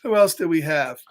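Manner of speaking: ordinary delivery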